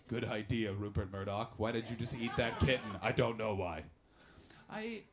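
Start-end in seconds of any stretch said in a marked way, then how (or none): 0.1-3.8 mock newsroom voices. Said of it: "And they're like".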